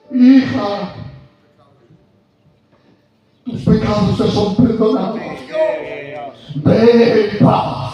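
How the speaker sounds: loud, high proclamation, very slow at the end; Jesus forgiving, proclaimed. Retold or imitated. Preacher voicing Jesus to the sinful woman, loud and drawn out. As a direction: proclaim the forgiveness, stretching "Ve en paz". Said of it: "le dice lo que Cristo quiere decir".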